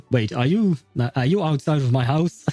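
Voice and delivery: ordinary delivery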